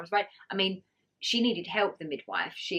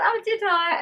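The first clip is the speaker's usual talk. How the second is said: high, loud mock surprise; 6 dB louder, slower than usual. Her own retold giggly reply.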